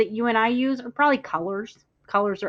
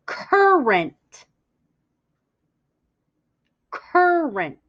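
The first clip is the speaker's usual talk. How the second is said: CURRENT stretched out, higher and louder, first syllable hammed up; ridiculously exaggerated; 5 semitones higher, 3 dB louder, much slower than usual. Shown as a demonstration. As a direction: ham up "Current." for the class to echo. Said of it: "I'm gonna do it a little ridiculously".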